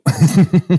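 high, loud laugh; burst of laughter. Real, not acted. Cohost laughing after his own parenting story, high and loud.

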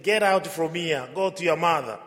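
mock-order someone out, loudly; retold fatherly outburst, punched out; angry dismissal.

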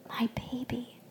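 hushed whisper, barely voiced; awed whisper. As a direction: whisper it to your newborn in wonder. New mother first holds her baby.

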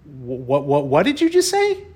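stunned, rising squeak of what; shocked what. Retold or imitated. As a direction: voice jumps way up in disbelief. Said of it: "I go".

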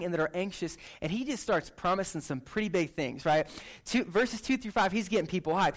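ordinary talk

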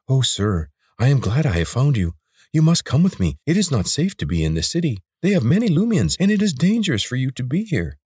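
high, urgent creature voice that leaps up; an anxious lizard urging his friend to hurry. Acted. Lizard ally Sarek urging Corvin.

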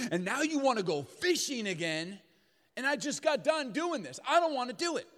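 complaining whine. Retold or imitated. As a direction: build a tired, aggrieved complaint. Peter complains to Jesus.

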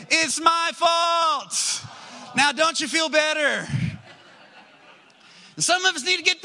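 loud, high, impassioned preaching; full-force preaching. Acted. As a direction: drive it home like a revival preacher.

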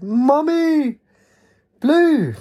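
very high, drawn-out squeaky cry; high-pitched squeak. A narrator's reading. Blue hears Katie and answers, high-pitched squeak. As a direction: squeal it high like an excited little creature. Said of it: "a high-pitched voice squeaked".

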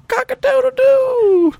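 high, slow rooster cock-a-doodle-doo; rooster crow. Shown as a demonstration. Listing onomatopoeias, crowing like a rooster up high. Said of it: "tell me some onomatopoeias".

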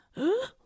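sharp, high theatrical gasp; mock gasp. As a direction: gasp as if scandalized. Mock shock at own claim.

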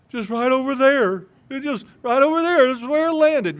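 crying man. Acted. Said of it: "Remember, he cried".

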